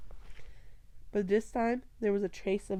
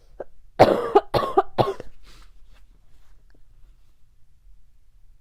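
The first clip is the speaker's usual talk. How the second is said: coughing fit; 9 dB louder than usual. Real, not acted. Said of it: "literally coughing".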